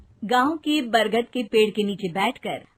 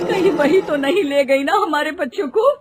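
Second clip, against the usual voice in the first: louder, higher fearful question; alarmed mother; 5 semitones higher, 8 dB louder than usual. Acted. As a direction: voice your dread, rising in alarm. Mother fears witch took the children.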